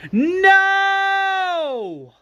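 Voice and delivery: long, high wail of no; drawn-out, high-pitched anguished scream. Retold or imitated. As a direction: scream it out and hold it. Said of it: "A J screams".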